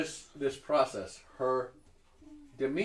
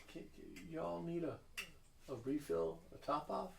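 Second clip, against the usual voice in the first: put-on polite, uneasy southern accent; uncomfortable southern hostess; 5 semitones higher, 10 dB quieter than usual. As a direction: offer refills in a nervous southern accent. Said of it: "she's a little uncomfortable".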